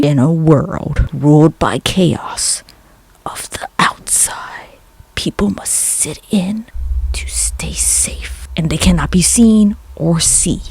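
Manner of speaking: slow whispered trailer narration; movie trailer voice. Pretend. Imagining the Bird Box pitch, slow whispered trailer voice. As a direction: tease it like an epic film trailer. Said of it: "Can you imagine the pitch meeting".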